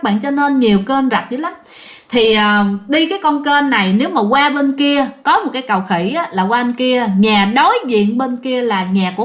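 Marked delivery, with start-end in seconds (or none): none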